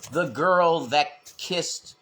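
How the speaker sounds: ordinary talk, nothing marked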